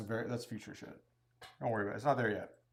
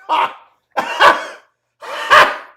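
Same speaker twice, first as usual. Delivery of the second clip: mocking laugh; 21 dB louder, much slower than usual. Mocking a doubter.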